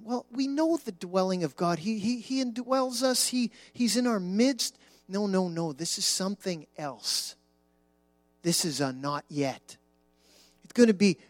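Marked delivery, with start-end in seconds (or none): none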